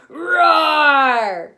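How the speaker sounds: huge loud shouted roar; loud playful roar; dinosaur roar. Shown as a demonstration. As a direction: roar out big for the kids. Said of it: "Let's do it".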